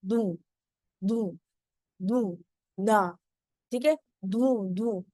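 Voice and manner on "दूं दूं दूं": दूं with air pushed out of the mouth, low pitch; denasalized demo. Shown as a demonstration. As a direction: isolate दूं, its N turning towards D. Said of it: "try to throw air outside".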